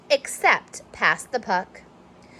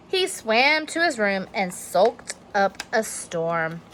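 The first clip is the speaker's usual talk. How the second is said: ordinary delivery, nothing marked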